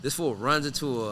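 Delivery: usual speaking voice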